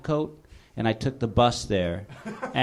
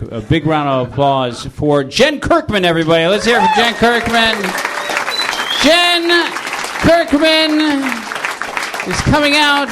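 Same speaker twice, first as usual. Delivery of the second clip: announcer; 8 semitones higher, 8 dB louder than usual. Acted. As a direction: hype the crowd for the guest. Introduces the next guest.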